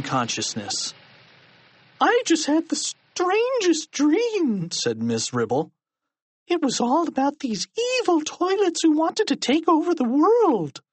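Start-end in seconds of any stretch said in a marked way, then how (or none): 2.0-4.7 very high, slow character voice; teacher character. Acted. Miss Ribble voiced in story. 6.5-10.8 very high-pitched character voice; teacher character. Acted. Miss Ribble voiced in story.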